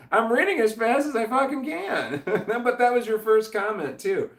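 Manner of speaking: high, loud words breaking into laughter; laughing protest. Real, not acted. Streamer answering an insulting chat message, laughing protest. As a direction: protest while cracking up.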